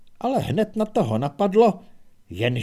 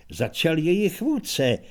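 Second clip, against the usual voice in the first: plain reading voice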